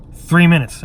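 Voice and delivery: normal talking voice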